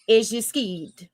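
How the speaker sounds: "skeed" slow, long ee, no r; Southern slang pronunciation. Shown as a demonstration. Repeating the name for the panel, slow Southern "skeed". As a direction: draw out "skeed" in place of scared. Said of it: "As in, is you scared?".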